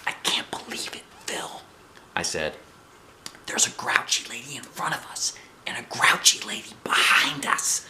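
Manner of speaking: hushed, breathy whisper between friends; secret whisper. A narrator's reading. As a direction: whisper it so nobody else hears. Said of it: "whispered to each other real secret".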